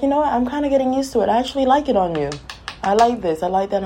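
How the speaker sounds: high, approving friend. Retold or imitated. People now liking her hair.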